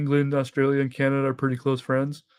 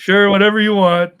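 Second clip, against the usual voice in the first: louder, higher, over-eager to please; mock agreeableness; 6 semitones higher, 9 dB louder than usual. Canadian who will never say it to your face, mock agreeable. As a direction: play the falsely easygoing Canadian. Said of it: "We're just kinda like".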